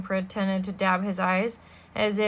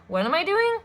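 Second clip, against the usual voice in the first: incredulous; 9 semitones higher than usual. Acted. Stefano denying Violet's accusation, high and incredulous. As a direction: question climbing very high.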